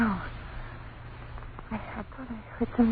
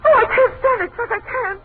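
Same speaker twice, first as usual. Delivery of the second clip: high, crying plea of terror; breaking down, begging her husband; terrified, near tears; 12 semitones higher, 4 dB louder than usual. Acted. Wife begging husband to stop the music, crying in terror.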